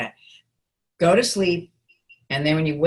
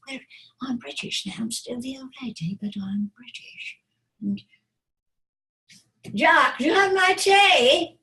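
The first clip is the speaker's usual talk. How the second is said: put-on British old lady; 7 semitones higher than usual. Shown as a demonstration. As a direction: play with a British accent as a grand old dame. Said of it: "if you do an accent".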